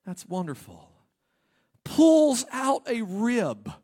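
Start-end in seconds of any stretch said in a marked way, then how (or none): 1.8-3.7 slow, louder, higher build; dramatic slow telling. Acted. Preacher acting out the rib surgery, slow and loud. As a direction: stretch every word out.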